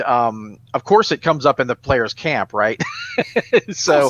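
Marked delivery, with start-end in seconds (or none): 2.9-3.6 high burst of laughter. Real, not acted. Laughs at the monster.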